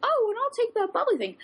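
high squeaky alien voice. Retold or imitated. Retelling the alien in the cinema ad, high squeaky voice. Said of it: "He's like".

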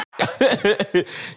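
a burst of high laughter. Real, not acted. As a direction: laugh out high after the shock. Laughs after outburst.

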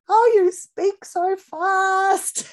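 drawn-out, high, loud mock complaint; loud, high-voiced mimicry of mum; imitating mum. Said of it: "And she said".

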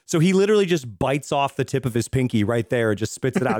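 usual speaking voice

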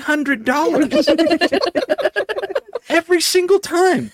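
can't believe how little they earn; incredulous. Retold or imitated. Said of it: "And I'm like".

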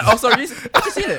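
laughing apology. Real, not acted. Mock apology while laughing.

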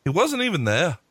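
put-on light British Davey Boy accent; a quick nod to Davey Boy's British accent. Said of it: "in his favorite Davey Boy accent".

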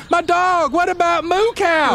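high, desperate call; shouting dog owner. Acted. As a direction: cry out for your dog. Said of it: "You hear Kevin Curtis shouting".

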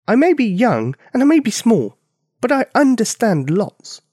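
put-on high, loud, defiant little girl; child declaring. Small girl insisting to the giant, high and loud. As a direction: stand tall and declare it. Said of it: "She declared".

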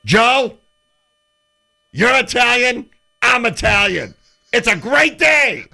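high, loud, slow proud Lasorda impression; hammed-up Lasorda. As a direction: ham up Lasorda beaming at Montana. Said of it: "He says".

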